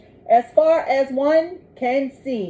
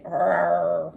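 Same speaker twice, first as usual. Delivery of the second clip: bear growl; 6 semitones lower than usual. Acted. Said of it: "A polar bear growls at it".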